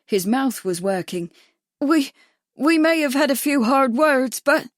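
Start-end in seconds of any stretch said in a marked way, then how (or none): 1.8-4.7 higher, louder, panicky stammering; frightened stammer. A narrator's reading. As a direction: stumble over the words in fear.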